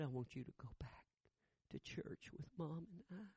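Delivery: put-on small boy's quiet request to his dad; soft child plea. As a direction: ask gently, almost afraid. Son asks father quietly.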